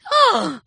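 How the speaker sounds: high gasping shriek; governess's shocked squeal, high. A narrator's reading. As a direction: squeal in outrage. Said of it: "взвизгивает фрейлин".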